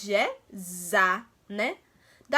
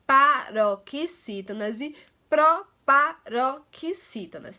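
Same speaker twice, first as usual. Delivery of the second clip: slow, spaced-out terms for pupils to echo; repeat-after-me delivery; 3 dB louder, slower than usual. Shown as a demonstration. Teacher leading pupils to repeat the terms with her, slow and deliberate. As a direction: sound out each term slowly, syllable by syllable. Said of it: "repitam com-, comigo".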